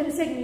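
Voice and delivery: "resigne" with a hard G before N; "resigne" read as spelled, G voiced; G wrongly sounded. Shown as a demonstration. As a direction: say "resigne" as re-sig-n. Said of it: "you don't say resigne".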